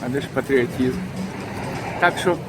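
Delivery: normal talking voice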